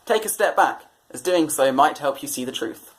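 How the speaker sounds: usual speaking voice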